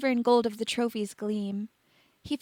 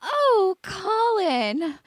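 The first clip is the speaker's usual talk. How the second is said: higher, swooning "Oh, Colin"; dreamy; 9 semitones higher, 4 dB louder, much slower than usual. A narrator's reading. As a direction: melt at the name. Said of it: "she smiled, looking dreamy".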